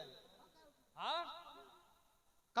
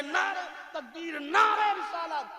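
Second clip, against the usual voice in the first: raised, high rallying cry; mock slogan shout; 7 semitones higher, 9 dB louder, slower than usual. Preacher imitating followers hailing a showy speaker, mock slogan shouting. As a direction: call out like a hired crowd leader.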